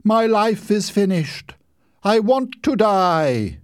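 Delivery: high, slow, melodramatic despair; teenage girl hamming up heartbreak; tragic heroine. A narrator's reading. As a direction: wail it like a stage tragedy. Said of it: "acted like the heroine of a tragedy".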